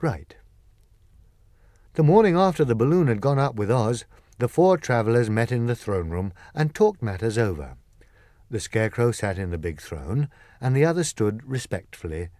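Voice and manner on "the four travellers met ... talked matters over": ordinary delivery, nothing marked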